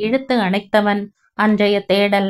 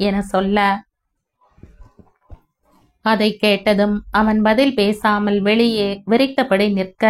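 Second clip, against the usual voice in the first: ordinary delivery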